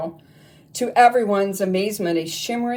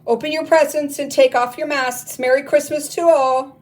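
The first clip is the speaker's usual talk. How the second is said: higher, louder happy announcement; joyful exclaiming; 8 semitones higher, 7 dB louder than usual. Acted. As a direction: proclaim it with festive joy. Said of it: "Gloria exclaimed".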